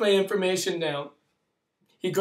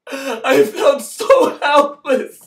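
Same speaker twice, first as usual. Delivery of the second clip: much higher, louder, voice breaking into tears; crying; 9 semitones higher, 8 dB louder, slower than usual. Real, not acted. Speaker telling how helpless he felt, crying. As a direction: let the helplessness break through.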